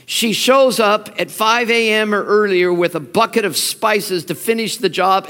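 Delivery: ordinary talk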